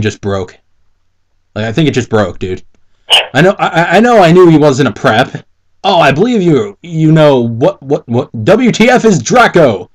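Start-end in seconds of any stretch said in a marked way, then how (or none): none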